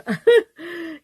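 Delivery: loud, high rueful laugh; burst of laughter. Real, not acted. Laughing at how expensive offices are, a rueful burst of laughter.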